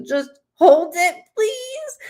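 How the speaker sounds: plead, rising into a squeak; high squeaky plea. Real, not acted. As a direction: voice climbing very high, squeaking on the last word. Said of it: "as I go into my squeaky voice".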